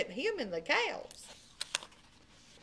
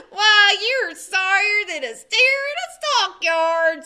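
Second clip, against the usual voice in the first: loud, slow, higher jeering insult; mocking sneer; 8 semitones higher, 11 dB louder than usual. A narrator's reading. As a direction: sneer down at Bubba. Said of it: "said Dwayne".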